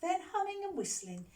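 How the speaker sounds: usual speaking voice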